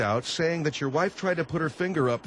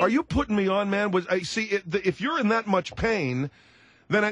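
raised pitch, challenging him; exasperated disbelief; 8 semitones higher than usual. Acted. Host suspecting the guest is putting him on, exasperated.

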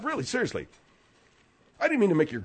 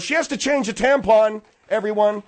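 loud announcement; 3 semitones higher, 6 dB louder than usual. Acted. Host tells everyone about Margaret's errand, loud announcement. Broadcasting her private remark aloud.

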